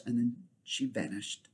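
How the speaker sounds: usual speaking voice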